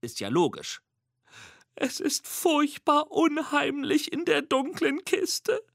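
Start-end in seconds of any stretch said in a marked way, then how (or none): 1.8-5.6 higher and slow, a frightened small monster; lightly hushed timid squeak; squeaky murmur. Acted. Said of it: "flüstert Mimi".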